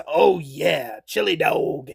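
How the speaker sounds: low, hushed, half-whispered mock movie voice; Drebin chili dog line. Frank Drebin savoring his chili dog, hushed low imitation. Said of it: "and he says".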